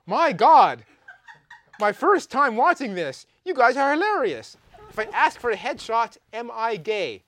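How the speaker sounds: loud, laughing, high-energy retelling; excited quote. Retold or imitated. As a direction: blurt it out like a thrilled fan. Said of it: "and he said".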